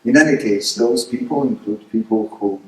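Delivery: usual speaking voice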